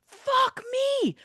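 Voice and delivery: high, loud imitation of a scream; retold scream. Retelling his outburst heard from downstairs, imitated scream. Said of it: "hear him screaming".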